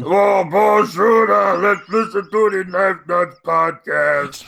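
loud, high, drawn-out alien boom; Jabba the Hutt. Acted. Host plugging the podcast as a giant alien, bellowing. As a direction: bellow it slowly like a huge creature. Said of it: "Jabba the Hutt".

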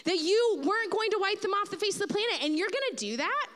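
high, pressing accusation; incredulous challenge. Retold or imitated. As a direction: throw God's own words back at him. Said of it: "He's saying".

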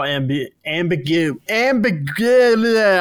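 stuck on the word, restarting; tongue-tied stumble, trailing high; cut off mid word. Real, not acted. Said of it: "I can't speak today".

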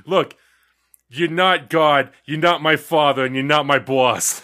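loud, slow, each NOT punched; mock angry rant. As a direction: mimic a furious little guy yelling. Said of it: "And I'm like".

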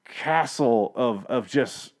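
normal talking voice

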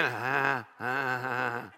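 a doubter stuck wondering, drawn out; lightly acted anxious believer second-guessing; worried doubt. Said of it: "don't start thinking".

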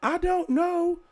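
very high falsetto lady voice; bad British lady falsetto. The Victorian lady answering the man, high falsetto. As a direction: squeak it out like a flustered lady. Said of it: "the lady's like".

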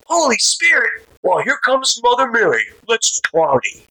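sneering at the Holy Spirit, cocky and loud; punk mockery. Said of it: "Just being a punk".

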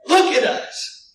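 high, loud forceful order; forceful command. Acted. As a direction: bark it with authority.

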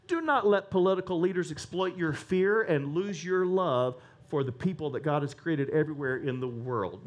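ordinary talk, nothing marked

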